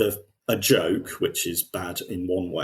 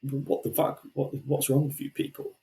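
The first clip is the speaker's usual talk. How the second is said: pitched up and hushed, baffled; a hushed disbelieving gasp at them; mock baffled outrage; 6 semitones higher, 6 dB quieter than usual. Fan baffled by the tournament, hushed and pitched up. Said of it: "makes you go".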